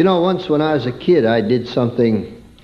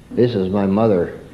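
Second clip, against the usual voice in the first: usual speaking voice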